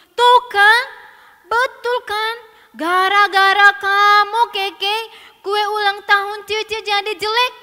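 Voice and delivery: put-on high, very loud child accusation; angry blame. Sister blaming Keke for the ruined cake, loud and cross. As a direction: rub it in, furious.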